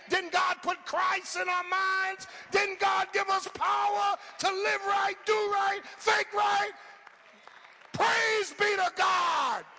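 shouted, high and slow, building praise; climactic preaching. Acted. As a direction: roar it to the rafters.

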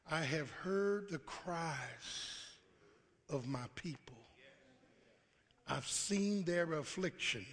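slow, soft and low; God comforting his people softly; tender God. Acted. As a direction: speak it with slow compassion.

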